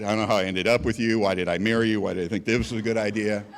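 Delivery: low, fed-up arguing with an absent wife; acted grumbling rant. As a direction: grumble to yourself as you re-enact the fight. Arguing alone, pacing in office.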